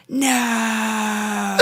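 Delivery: a high hammed-up wail; anguished no. Holding his dead partner, high mock wail. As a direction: grieve over your partner, overacted.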